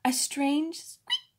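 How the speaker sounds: plain reading voice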